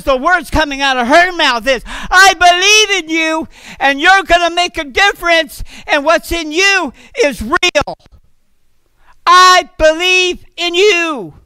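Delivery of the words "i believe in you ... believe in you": higher, louder, slow and deliberate; declaring love with slow, ringing conviction; emphatic affirmation. Acted. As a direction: proclaim every word of love like a promise. Wife's affirmation proclaimed.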